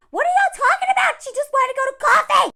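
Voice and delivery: very high, fast, loud squawk; mock outrage. Reacting to a guy's tweet, high mock outrage. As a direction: rattle it off in high-pitched disbelief.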